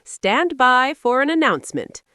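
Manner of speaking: loud, ringing fairground announcer; booming announcer. A narrator's reading. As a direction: boom it over the loudspeaker. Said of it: "boomed the voice of the loudspeaker".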